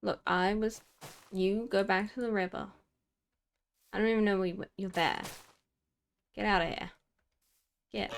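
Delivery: loud, exasperated telling-off; scolding a goose. Real, not acted. Player scolding the goose that honked at her, loud and exasperated. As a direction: shoo the bird away, raising your voice.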